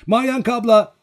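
put-on high, loud child's call; high-pitched shout across a crowd. A narrator's reading. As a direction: call loudly to big sister. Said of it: "diye seslendi".

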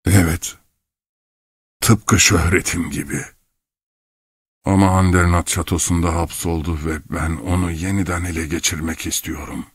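lightly voiced old man, sinking at the end; brooding old clockmaker. A narrator's reading. Old clockmaker wants his soul back, lightly voiced brooding. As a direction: brood aloud with long pauses.

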